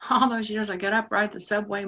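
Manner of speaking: ordinary talk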